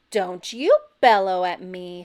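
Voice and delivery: loud angry yell; furious loud retort. A narrator's reading. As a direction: yell back at him. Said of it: "she yelled".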